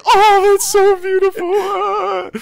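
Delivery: high, loud swooning awe; mock awe. Retelling his reaction to the game scene, loud awed swoon. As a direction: swoon at the scene like it's a miracle. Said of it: "I was like".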